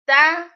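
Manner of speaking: "está" with the last syllable high and loud; "está" rising hard onto the accented TA; stress on final A. Shown as a demonstration. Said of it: "with the accent".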